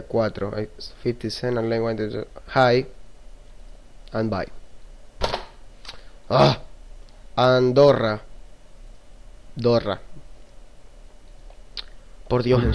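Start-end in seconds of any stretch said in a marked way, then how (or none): none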